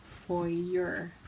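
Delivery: foyer said "FOY-yer"; mocked pronunciation. Shown as a demonstration. Newcomer to Canada confessing the mocked foyer, "FOY-yer". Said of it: "I got destroyed for pronouncing foyer like".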